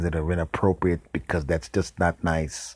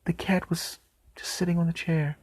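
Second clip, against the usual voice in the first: high whispered reveal; whispered reveal that the cat never left; 10 semitones higher, 4 dB quieter than usual. Real, not acted. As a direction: whisper the punchline.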